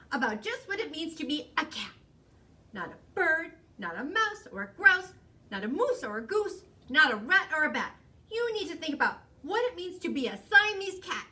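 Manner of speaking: loud, high-pitched scolding lecture; stern mama reeling off a list; lecturing mother. A narrator's reading. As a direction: hammer each item home to the child. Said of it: "The lecture went on and on".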